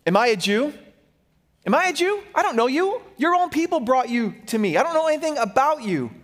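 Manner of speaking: sharp, rising retort, mock-indignant; a defensive governor snapping back at a prisoner, lightly voiced; retold snap. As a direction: throw the questions back at him, half-acting the irritation. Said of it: "snaps back and says".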